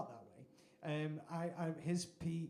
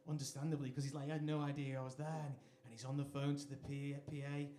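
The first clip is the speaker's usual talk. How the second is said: usual speaking voice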